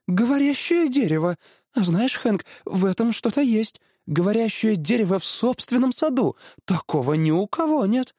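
high, dawning excitement; delighted wife. Acted. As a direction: marvel aloud as the idea appeals. (восторженный высокий женский голос)

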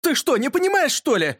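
high-pitched, exasperated outcry; exasperated yell. A narrator's reading. Yurka to friend who won't understand, exasperated yell. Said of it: "закричал Юрка".

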